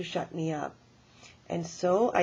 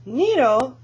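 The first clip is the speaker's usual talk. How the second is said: loud, rising, disbelieving Nero; incredulous grandmother; indignant surprise; 6 semitones higher, 8 dB louder than usual. Acted. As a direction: scoff at the question. Granny indignant at the question.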